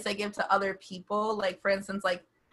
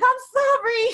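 high, loud, hammed-up sorry; playful self-mocking apology; 14 semitones higher, 8 dB louder than usual. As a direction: squeal an exaggerated apology for being late. Mock apology for being late.

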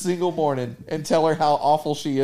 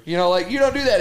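ordinary delivery

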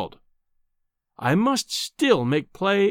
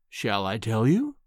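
low, slow, softened coo; 4 semitones lower than usual. A narrator's reading. Professor baiting his visitor, cooing. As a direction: coo it slowly, rising at the end. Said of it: "he cooed".

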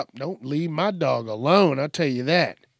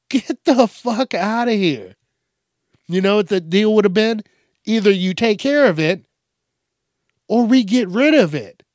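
loud, heated rant at lazy owners; angry rant; 5 semitones higher, 6 dB louder than usual. Real, not acted. Comedian ranting at owners who dump dogs, loud and fed up. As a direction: lay down the law, fed up.

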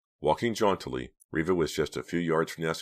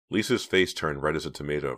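plain reading voice

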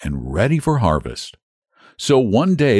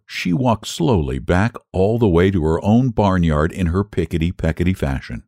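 ordinary delivery

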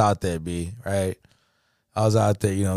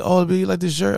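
put-on girlfriend voice; 8 semitones higher, faster than usual. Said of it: "she's like".

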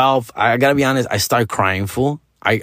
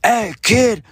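dad's command; 8 semitones higher, much slower than usual. Retold or imitated. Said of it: "And he's just like".

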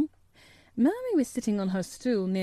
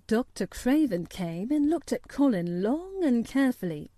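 plain reading voice

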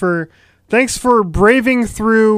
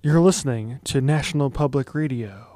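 low, measured public-radio announcer; hushed, lower NPR-style station ident; NPR host voice; 5 semitones lower, 4 dB quieter than usual. Acted. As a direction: intone it softly like a mock NPR host. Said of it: "call it my N P R voice now".

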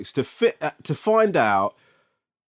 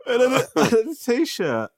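high words through laughter; laughing while talking; 11 semitones higher than usual. Real, not acted. Laughing while telling of playing outside in boxers. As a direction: push the words out between laughs.

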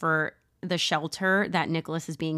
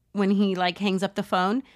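ordinary talk

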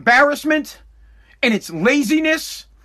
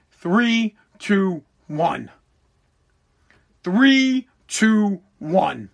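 slow repetition; 3 semitones lower, much slower than usual. Real, not acted. Repeating the vote margin.

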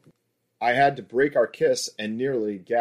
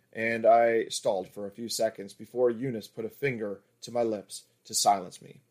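ordinary delivery, nothing marked

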